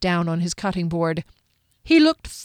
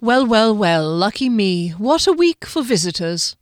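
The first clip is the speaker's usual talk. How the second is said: dry; 3 semitones higher, 5 dB louder than usual. A narrator's reading. Wizard at his door greeting another visitor, dryly. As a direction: greet them with dry mock delight. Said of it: "Kami said dryly".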